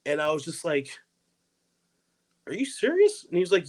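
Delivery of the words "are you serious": high-pitched, incredulous question; retold disbelief. Retelling his amazement at an old man handing him money, high and incredulous. Stunned by unexpected gift of money.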